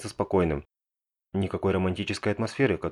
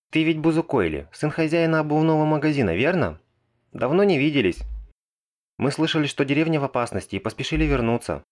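put-on higher, louder girlish voice; girl's voice, high; 8 semitones higher, 4 dB louder than usual. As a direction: voice the young mage girl. Megumin's voice in the story. (высокий девичий голос)

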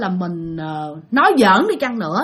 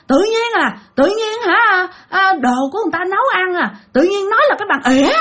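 much higher, whiny complaint, faster at the end; offended possessed father; 9 semitones higher than usual. Retold or imitated. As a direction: grumble like an offended old spirit. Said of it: "ba mới trả lời vậy nè".

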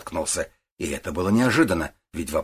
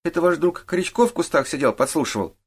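put-on higher, indignant boyish question; accusing boy; 10 semitones higher than usual. A narrator's reading. Boy suspects the detective's friend eavesdropped, accusing. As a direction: start high with suspicion, then ease down.

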